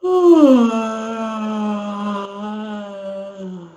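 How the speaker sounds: long falling yawn sigh. Shown as a demonstration. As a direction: let a yawn fall out. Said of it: "So do it again".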